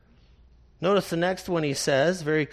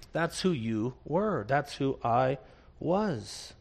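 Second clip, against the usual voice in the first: low and much softer, humbled; quiet confession; 5 semitones lower, 6 dB quieter than usual. Acted. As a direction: admit it softly to the church.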